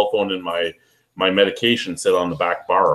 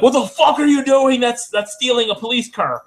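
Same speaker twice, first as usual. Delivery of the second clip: high, loud mock cop yelling; angry cop; 14 semitones higher, 3 dB louder than usual. Cop yelling at a coworker on a police bike, mock angry. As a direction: bark it like a startled cop. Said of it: "and he's like".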